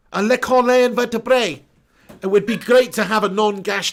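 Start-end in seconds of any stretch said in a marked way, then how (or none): 0.1-1.6 put-on louder hammy French accent; French chef. Hammed French phrase.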